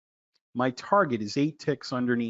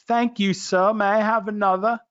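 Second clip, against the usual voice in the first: mock grateful servant; 6 semitones higher than usual. Mock thanks after taking profit.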